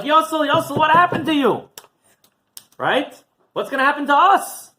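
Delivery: high, loud alarmed cry of shock. Retold or imitated. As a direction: cry out in panic at the sight. Friends shocked at blackened Yossel.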